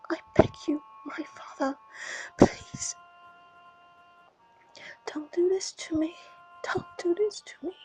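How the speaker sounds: broken, breathy begging in tears; tearful pleading whisper. Acted. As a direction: plead with the dead in a crying whisper. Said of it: "he sobs and sobs".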